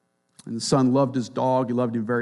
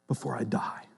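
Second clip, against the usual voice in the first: breathy whisper, barely voiced; 3 semitones lower, 11 dB quieter than usual. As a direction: let it fade into a whisper. Quiet ending of quoted wish.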